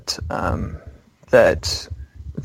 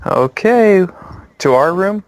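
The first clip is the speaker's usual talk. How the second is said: put-on high, louder little girl voice; 7 semitones higher, 4 dB louder than usual. Girl checking where the visitors want to go, high child voice. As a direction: pitch it up like a small child asking. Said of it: "the little girl goes".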